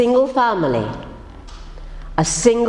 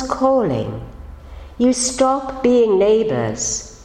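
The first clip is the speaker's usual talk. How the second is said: poetry reading. Acted. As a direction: let each line fall and settle. Poem read aloud before the story.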